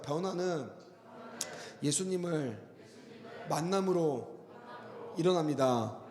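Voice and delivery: 변화는 예수님을 만남으로 일어납니다 said word by word with long pauses for the congregation to echo; spaced out, each word a call for the room to repeat; slow call-and-response line, 변화는 / 예수님을 / 만남으로 / 일어납니다; phrase for repeating. Shown as a demonstration. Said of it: "따라해보자".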